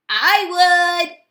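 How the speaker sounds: high, loud, bold answer; spiky sea urchin. A narrator's reading. Sea urchin answering the crab, high and loud. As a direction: snap back spiky and bold. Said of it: "answered a spiky sea urchin".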